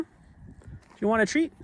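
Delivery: high sing-song dog voice; talking to a dog. Real, not acted. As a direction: coax the dog, pitch way up.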